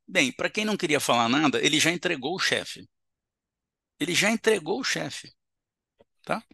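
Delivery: usual speaking voice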